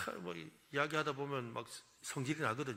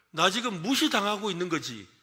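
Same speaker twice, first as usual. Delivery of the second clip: mock hurt; 6 semitones higher, 8 dB louder than usual. Shown as a demonstration. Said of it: "이렇게 해주라는 거예요".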